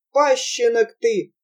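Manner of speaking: usual speaking voice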